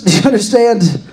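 usual speaking voice

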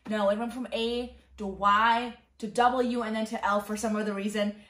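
usual speaking voice

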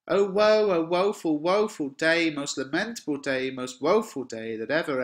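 high, loud wail fading toward normal voice; theatrical lament. Acted. Nurse lamenting the woeful day, hammed-up wailing. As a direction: start on a big woeful cry, then let it sink.

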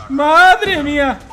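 drawn-out loud cry, slowed; slow loud exclamation. Real, not acted. Gamer in awe at his streak, slow loud cry. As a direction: stretch it out in awe.

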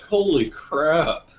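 slow, high, loud shocked exclamation. Retold or imitated. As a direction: gasp it out in disbelief. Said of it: "I'm like".

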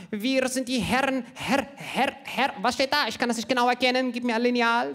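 a blind man who can't read his notes; squinting Himmler. Retold or imitated. Short-sighted Himmler failing to read his speech at the rally, high and fumbling. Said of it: "Der stand dann da immer".

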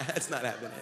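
low, quiet laughing denial; mock-oblivious, under-the-breath brush-off; pretending nothing's happening. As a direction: laugh softly as if ignoring it. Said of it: "acting like it's not happening".

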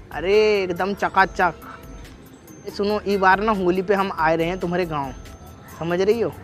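normal talking voice

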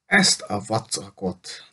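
slow, fuming grumble at the bike. A narrator's reading. Jason fuming at his useless bike, slow and irritated. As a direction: spit out the words in annoyance. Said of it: "dühöngött Jason".

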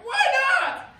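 very high, loud shouted question; yelling back. Retold or imitated. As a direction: fling it back like an angry kid. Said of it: "he yells back".

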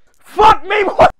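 loud, high yell of disbelief; real high-pitched outburst; startled shout. Real, not acted. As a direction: shout out in shock mid-fight. Startled yell during boxing game.

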